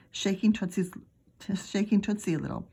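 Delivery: usual speaking voice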